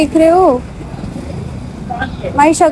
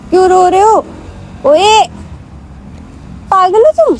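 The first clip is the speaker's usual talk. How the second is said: playful scolding; 5 semitones higher than usual. Real, not acted. Girlfriend scolding Sohan for crying in public, teasing. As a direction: shake him out of crying.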